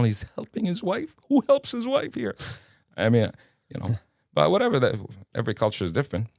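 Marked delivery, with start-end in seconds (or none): 0.0-2.3 very high, comic incredulity; amazed disbelief. Retold or imitated. Guest marvelling at a professor helping his wife, high and incredulous. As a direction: let the voice shoot up in astonishment.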